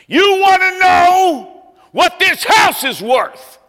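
put-on old man shouting at the room over a boy; yelling. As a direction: shout it so the whole room hears. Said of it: "he yells".

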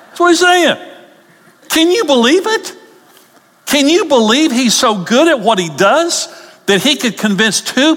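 voice shoots up. Real, not acted. Preacher exasperated by the devil's lie, voice shooting up. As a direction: squeak up in exasperation. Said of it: "my voice goes up".

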